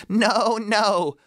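put-on high kid goat voices, scolding; high children's voices refusing the wolf. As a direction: sound like bold little kids. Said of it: "one of the goats said".

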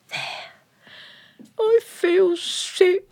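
whiny complaint. Acted. Sulky queen refuses medicine.

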